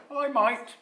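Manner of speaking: high, loud little creature voice; young creature. A narrator's reading. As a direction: play a young creature answering. Small creature answers in story.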